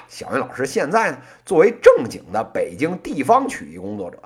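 normal talking voice

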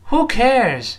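high-pitched, projected "who cares"; read aloud boldly. Shown as a demonstration. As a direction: call it out high for repeating. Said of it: "我们大声读一遍".

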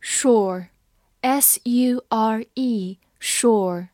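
Sure spelled S U R E, said slowly; slow spelled pronunciation. Shown as a demonstration. Teacher modeling sure slowly for students. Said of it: "英式的发音里有两个发音".

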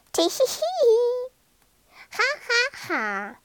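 high, acted tittering; sly high "tee-hee" and soft ha-has; monkey giggle. A narrator's reading. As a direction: giggle like tiny tricksters. Monkeys giggle at their secret trick.

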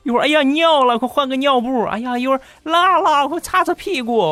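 high, fussing mock new-parent voice; flustered parent. New father fussing over crying baby, high flustered voice.

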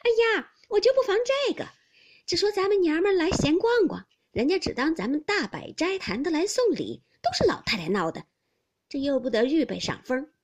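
high, loud, lively fluster; laughing exclamation. A narrator's reading. Fengjie claps on hearing gifts have arrived, laughing exclamation. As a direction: burst out in amused dismay. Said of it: "拍手笑道".

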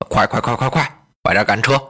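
low, pressing order to drive on; a low, tense command to hurry; hurried urging. Acted. Li Su urges the driver to rush the cart, low and tense.

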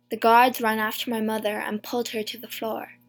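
ordinary delivery, nothing marked

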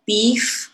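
Beef with the final f sounded clearly; Beef, don't drop the f at the end. Shown as a demonstration. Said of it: "那个f-不要忘记了". (Beef结尾的f要清楚念出来)

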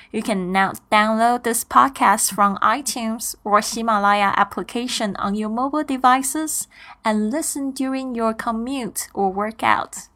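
ordinary talk, nothing marked

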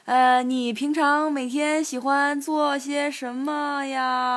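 拖长音、慢悠悠地模仿搭讪的人; 慢慢拖着高音套近乎地问; 模仿印度人搭讪. Retold or imitated. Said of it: "他就会跟你说". (慢吞吞拖腔学人搭讪)

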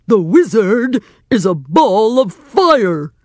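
big, emphatic lion; lion character. A narrator's reading. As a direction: insist boldly as a storybook lion. Lion insists.

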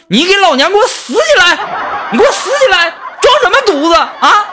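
furious roaring. Retold or imitated. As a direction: shriek at him like a fed-up girlfriend. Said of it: "冲向睡在沙发上的男朋友就咆哮啊".

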